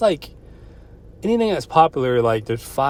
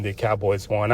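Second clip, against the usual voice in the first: ordinary delivery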